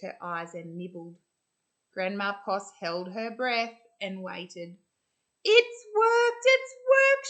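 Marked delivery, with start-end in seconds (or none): 5.4-7.3 much higher and louder, overjoyed; joyful cry. Acted. Grandma Poss crying out in joy as the magic works. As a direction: burst out with delight. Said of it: "she cried".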